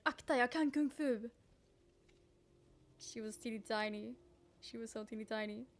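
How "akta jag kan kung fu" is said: lightly voiced little Swedish child; a small kid's warning in Swedish; Swedish five-year-old. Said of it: "a Swedish little five-year-old just saying".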